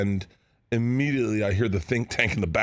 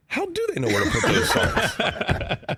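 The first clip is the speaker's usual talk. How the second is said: high, slow retelling breaking into laughter; slow high-pitched wondering through laughs; pondering to himself; slower than usual. Retold or imitated. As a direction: retell your own baffled thought, laughing. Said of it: "thinking to myself, honestly".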